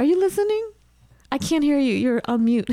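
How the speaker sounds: high-pitched teasing check; playful calling out. Real, not acted. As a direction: call over in a high, playful voice. Teasing guest who should not hear.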